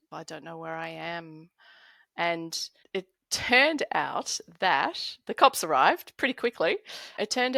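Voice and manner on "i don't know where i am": much softer, lost and confused; dazed and lost. Retold or imitated. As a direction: murmur it like someone who has no idea where he is. Dazed thief caught in hearse.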